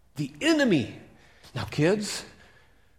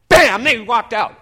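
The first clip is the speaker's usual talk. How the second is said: loud burst, slower; sudden loud bam; 5 semitones higher, 13 dB louder than usual. A narrator's reading. Preacher startling the congregation, loud. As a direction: blast it out to startle.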